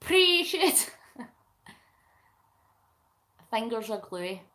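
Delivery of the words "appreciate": put-on another failed go at a friend's accent, higher; higher-pitched imitation of a friend's accent; Mel's accent. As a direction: have a clumsy try at your friend's accent. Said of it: "I can't do Mel's accent".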